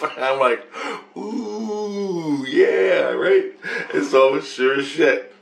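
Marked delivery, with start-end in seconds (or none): 1.2-3.5 squealing high, gleeful ooh yeah right; thrilled by the idea, voice jumps high; delighted ooh. Retold or imitated. Answering his innocence with high delighted glee.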